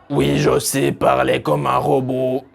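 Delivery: flat mechanical robot voice. Shown as a demonstration. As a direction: speak like a stiff machine. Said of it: "comme si je parle en robot". (voix de robot)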